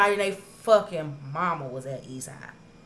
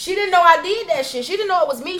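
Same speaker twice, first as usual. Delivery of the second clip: excited; 11 semitones higher, 8 dB louder than usual. Real, not acted. Laughing excited retelling.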